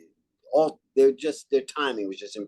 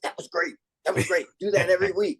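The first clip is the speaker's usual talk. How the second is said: higher, eager praise with the first words way up; excited producers; 7 semitones higher, faster than usual. Retold or imitated. Retelling what producers said after the show, high and gushing. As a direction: gush like a thrilled producer. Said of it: "the producers came up and said".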